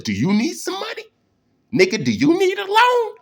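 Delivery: sudden squeaky high mock voice, fast; mock high offer. Teasing a listener about needing a loan, high mock voice. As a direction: pitch way up mocking a generous friend.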